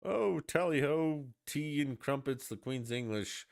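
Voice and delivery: hammed-up posh English voice, slow and high; mock upper-class English accent; mock British accent. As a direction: play a cartoon Brit. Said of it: "British national who was not, like".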